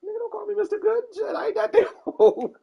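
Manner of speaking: high, loud, laughing protest; mock offended. Thinking back at the kids, high laughing mock offense. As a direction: play the insulted old man, cracking up. Retold inner reaction, laughing.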